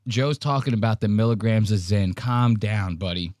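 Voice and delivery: ordinary talk, nothing marked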